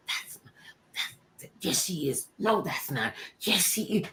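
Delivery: soft back-and-forth argument; hushed bickering. Retold or imitated. Twins debating a celebrity sighting, hushed. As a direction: argue it under your breath.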